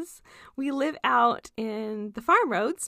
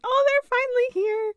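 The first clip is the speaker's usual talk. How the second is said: high, loud thrilled squeal; excited squeal; 11 semitones higher, 6 dB louder than usual. Retold or imitated. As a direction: squeal with delight at the first violets. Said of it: "I'm like".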